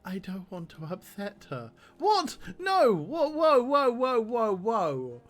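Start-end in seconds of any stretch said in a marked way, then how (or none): 2.0-2.9 high, loud shocked yelp; shocked outcry. Real, not acted. Shocked reaction to game text. 2.9-5.2 loud high whoas fading down; stunned whoa. Real, not acted. Reacting to startling game option.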